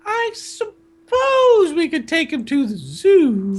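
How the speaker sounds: high falsetto aunt, drawing out every word; slow Aunt Petunia. Acted. Aunt suggesting the zoo to her husband, slow falsetto. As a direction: drag it out slowly in a high girl voice. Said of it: "Aunt Petunia said slowly".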